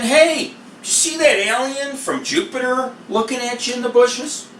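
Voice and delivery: hammed-up high-pitched query; mock casual question. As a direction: put on a high voice for an absurd pretend question. Imagined absurd question, mocking the idea.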